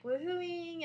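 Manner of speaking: high, drawn-out whoop inside the word; mock woohooing. Describing excited guests cheering, playful mock whoop. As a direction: stretch it out like a cheer.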